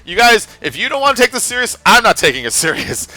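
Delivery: loud, high, fed-up rant; exasperated outburst. Retold or imitated. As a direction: throw up your hands and yell. Retold outburst quitting the game.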